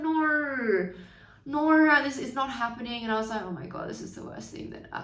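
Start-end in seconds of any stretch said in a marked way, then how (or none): 0.0-3.0 higher, crying through the words; tearful protest. Retold or imitated. Retold crying in lesson. 3.4-5.0 whispered despair. Retold or imitated. Retold panicked inner thought.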